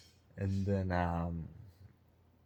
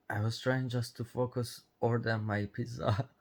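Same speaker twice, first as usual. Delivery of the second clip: normal talking voice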